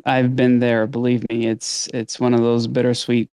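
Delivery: ordinary delivery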